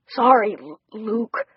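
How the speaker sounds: stammering with chattering teeth; shivering with cold, catching on the name. Acted. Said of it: "teeth chattering".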